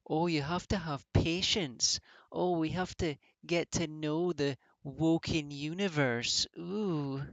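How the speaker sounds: slow, sing-song mock lecture; mocking fans. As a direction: drag it out to mock people who defend the feud. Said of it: "But people are like".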